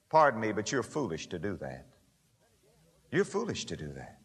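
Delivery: dropped soft and low after the shouting; quiet aside. Real, not acted. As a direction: lean in and say it gently but bluntly.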